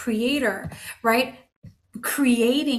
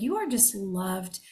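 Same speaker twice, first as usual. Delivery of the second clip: softer, slow and tender; 3 semitones lower, 6 dB quieter than usual. Retold or imitated. Mother to her kids: soft, tender reassurance. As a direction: say it gently, like holding a child. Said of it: "being like".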